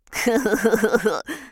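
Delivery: loud, high cackling laugh; mocking laugh. A narrator's reading. Durcheinander laughs at the angry Ordnung, mocking cackle. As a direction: laugh loudly in a rattling burst. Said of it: "lachte das Durcheinander".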